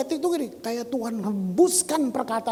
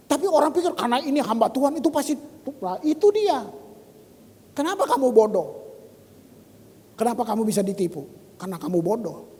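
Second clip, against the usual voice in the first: normal talking voice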